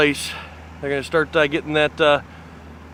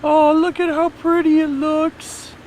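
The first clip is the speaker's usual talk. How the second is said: cooing voice; 11 semitones higher, slower than usual. Pretend. Cooing at the view.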